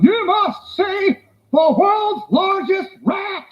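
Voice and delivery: slow, high, shouted barker pitch; barker yell. Retold or imitated. Sideshow barker calling to passersby, loud and slow. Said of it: "he was just yelling at people".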